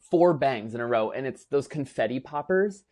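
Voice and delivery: usual speaking voice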